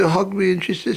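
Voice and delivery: ordinary delivery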